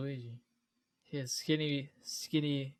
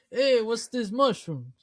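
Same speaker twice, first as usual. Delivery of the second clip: put-on slow, high, loud Brooklyn accent; 7 semitones higher, 5 dB louder, slower than usual. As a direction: ham up a thick Brooklyn accent. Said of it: "super thick Brooklyn accent".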